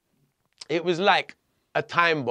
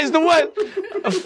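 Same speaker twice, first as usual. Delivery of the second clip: very slow, laughing it out; stretched laughing disbelief; 12 semitones higher, much slower than usual. Retold or imitated. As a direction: drag it out through laughter. Disbelief at priest arriving mid-raid.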